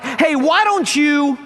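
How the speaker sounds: high, slow indignant start; lightly voiced wife cutting in; wife's retort. Wife begins to scold the driver, high and slow. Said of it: "his wife's like".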